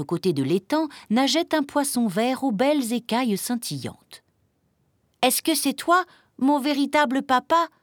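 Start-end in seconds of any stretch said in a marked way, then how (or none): 5.2-7.7 high, eager questioning voice; little chick brimming with hope; hopeful little chick. A narrator's reading. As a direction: ask high and full of hope. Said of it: "s'enquit le petit poussin vert plein d'espoir".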